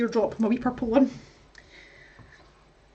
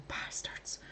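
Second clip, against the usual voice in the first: unvoiced sleepy whispered swear; whispered curse; 19 dB quieter than usual. Partner swearing in his sleep, whispered. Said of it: "he was saying".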